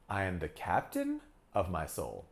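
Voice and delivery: questioning upswing on "captain", low ending; rising pitch on CAPTAIN. Shown as a demonstration. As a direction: lift "captain" sharply like a question, then pause. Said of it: "with a rising pitch".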